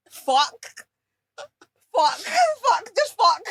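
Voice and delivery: high, loud, exasperated cursing; re-enacted exasperation. Telling her friend how she took the bad news, exasperated. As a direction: curse in bursts, pitch shooting up. Retelling her reaction to the call.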